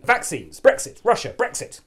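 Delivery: high, loud list of buzzwords; political pivot. Pretend. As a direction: jab out each distraction separately. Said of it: "I'm gonna do one of my pivots".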